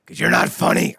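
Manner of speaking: loud, rough monster snarl; hammed-up menacing shout; Sweet Tooth roar. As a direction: bellow it as the killer clown. Sweet Tooth attacking the sitcom star.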